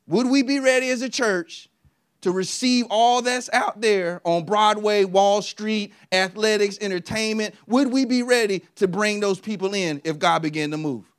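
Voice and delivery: a full-voiced sermon climb over music; preaching. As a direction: build it up to the congregation.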